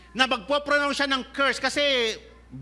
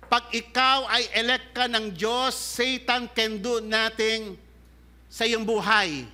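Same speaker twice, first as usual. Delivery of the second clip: usual speaking voice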